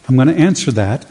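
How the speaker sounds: usual speaking voice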